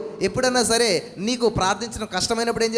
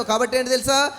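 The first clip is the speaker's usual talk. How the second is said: normal talking voice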